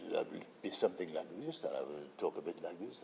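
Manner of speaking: soft French-accented English, a quick sample. Shown as a demonstration. Actor shows the director his French accent, softly. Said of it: "if you can do a French accent".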